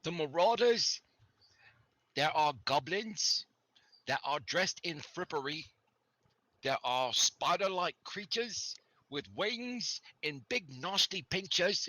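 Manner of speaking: a frightened local describing the attackers; NPC storyteller voice. Acted.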